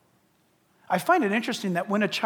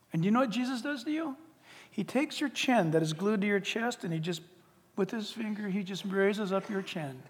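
ordinary delivery

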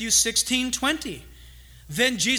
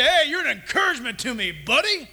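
hearty manly greeting; 4 dB louder than usual. Acted. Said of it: "you go up there and you say".

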